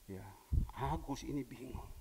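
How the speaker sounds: ordinary talk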